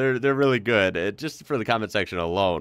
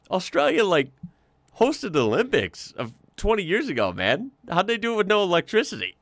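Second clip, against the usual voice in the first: exasperated disbelief; 10 semitones higher than usual. Real, not acted. Mock outrage at the question.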